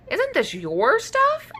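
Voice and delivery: surprised question. Acted. Dino asks, surprised.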